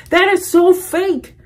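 high, loud mock outrage; exasperated disbelief. Reacting to fans praising a fake accent, high exasperated outburst. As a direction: pitch it way up, stressing each word in protest.

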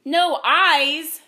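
loud, high booming outrage; indignant roar of disbelief. A narrator's reading. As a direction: boom it out as an outraged magistrate. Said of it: "the magistrate boomed".

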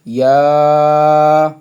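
"ইয়া" with a long held final vowel; tarikan satu alif. Shown as a demonstration. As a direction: stretch "ইয়া" on one level note. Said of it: "এক আলিফ".

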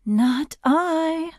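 high-pitched, dainty gazelle voice; elegant gazelle. A narrator's reading. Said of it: "said the elegant gazelle".

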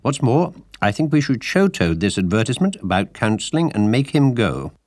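high, loud, firm insistence; insistent Rat. A narrator's reading. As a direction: insist on the plan.

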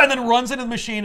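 fast, loud excited telling; excited shout. Real, not acted. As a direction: rush the words out loudly. Hurried narration after the gasp.

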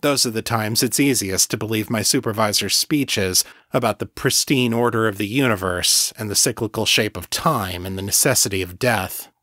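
usual speaking voice

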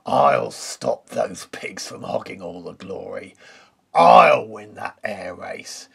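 slow, loud villainous wolf voice, dropping to a hiss on the race; wicked wolf plotting revenge; snarling wolf. A narrator's reading. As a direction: gloat over the pigs like a scheming wolf. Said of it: "snarled the wolf".

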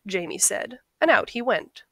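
ordinary delivery

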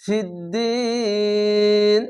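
ফিদ্দিন with a very long held "ee"; three-alif stretch. Shown as a demonstration. Teacher showing the stop at verse end, vowel greatly prolonged. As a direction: stretch the second vowel of ফিদ্দিন steadily before closing on N. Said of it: "তিন আলিফ টেনে পড়বেন".

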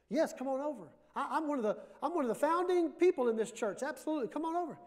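put-on high, proud old lady's voice; proud grandma. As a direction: puff up like a founding church matron. Grandma invites preacher over.

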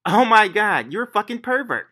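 high, loud mock outrage; outraged woman imitation.

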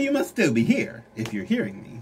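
ordinary talk

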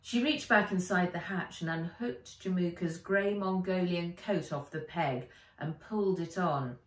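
ordinary delivery, nothing marked

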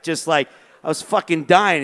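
normal talking voice

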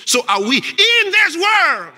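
slow, high-pitched proclamation building in force; emphatic preaching shout. Real, not acted. Preacher drives home quoted verse.

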